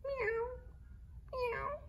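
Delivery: very high, soft distant meow; a tiny kitten crying far off; faint meow. A narrator's reading. Lost kitten crying faintly from the drain, faint meow. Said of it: "a faint cry in the distance".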